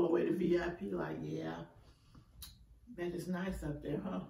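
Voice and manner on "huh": usual speaking voice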